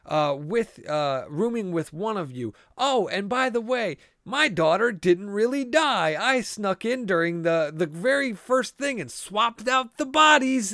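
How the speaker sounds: hammed-up killer listing his tricks; a podcaster mocking a killer's over-explained twist, high and loud; mocking villain reveal. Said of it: "Where they're just like".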